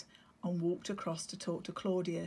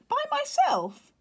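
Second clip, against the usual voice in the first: very high, loud anxious squeak; nervous chick; 10 dB louder than usual. Acted. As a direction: doubt being sent alone. Leo nervous.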